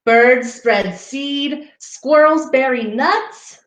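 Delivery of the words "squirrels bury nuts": ordinary delivery, nothing marked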